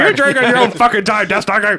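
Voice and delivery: high mock yell at him; hammed-up shout at the hero; nagging witch yell.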